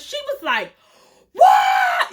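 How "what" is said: high, loud shriek of disbelief; shocked scream. Retold or imitated. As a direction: shriek it at the top of your voice. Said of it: "she just started screaming".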